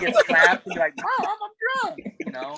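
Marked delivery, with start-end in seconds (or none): 1.0-2.0 high-pitched, drawn-out mock wail to mom; mock whiny child. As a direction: imitate someone dropping the act, high and slow. Mocks a front dropping when slapped.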